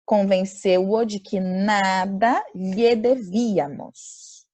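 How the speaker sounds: very slow and deliberate; slow model reading. Shown as a demonstration. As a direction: read it out word by word for students. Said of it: "Então".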